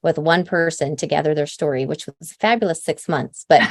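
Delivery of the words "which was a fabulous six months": ordinary talk, nothing marked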